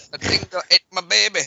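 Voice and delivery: loud, high mock cry; movie quote. Echoing a friend's film quote, loud mock cry. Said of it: "that's my favorite line from, uh, Tropic Thunder".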